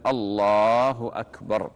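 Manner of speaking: slow, full "الله أكبر"; demonstrated takbir. Shown as a demonstration. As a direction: call "الله أكبر" as if opening prayer. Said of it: "العمل على الأولى".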